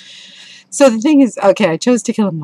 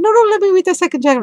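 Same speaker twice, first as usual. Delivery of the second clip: much higher, fast, pushing to keep reading; eager insistence; 7 dB louder, much faster than usual. Retold or imitated. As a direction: playfully insist on going on.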